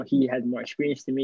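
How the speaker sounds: normal talking voice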